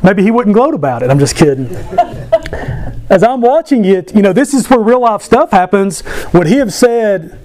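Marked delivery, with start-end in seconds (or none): 1.7-3.0 a quick breathy high laugh; light laugh. Real, not acted. Pastor laughing at his Georgia jab, light laugh. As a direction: laugh off the joke.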